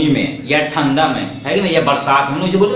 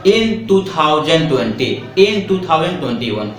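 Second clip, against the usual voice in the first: slow dictation; much slower than usual. Shown as a demonstration. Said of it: "teacher dictates years slowly".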